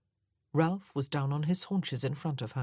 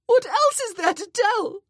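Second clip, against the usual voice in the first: high, loud, anguished cry; distraught sobbing question; wailing; 11 dB louder than usual. A narrator's reading. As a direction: cry it out, past all control. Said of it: "She hardly recognized her own voice".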